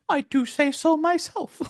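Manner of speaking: very high put-on hero voice; squeaky, comic self-congratulation; mock self-praise. Imitating Deku's proud reply.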